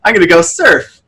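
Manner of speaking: retelling his own giddy joy at the new board; thrilled customer. Retold or imitated. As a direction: gush like a kid with a new toy. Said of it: "and I was just like".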